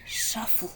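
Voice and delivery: breathy unvoiced shuffle noise; whispered sound effect; shuffling sound. A narrator's reading. Sound effect in read story.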